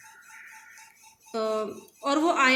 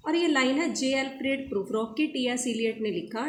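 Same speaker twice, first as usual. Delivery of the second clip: ordinary talk, nothing marked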